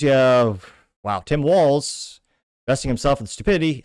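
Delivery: normal talking voice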